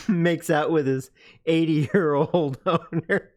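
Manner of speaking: retelling a joke through real laughter; cracking up. Real, not acted. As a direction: keep breaking into laughs mid-sentence.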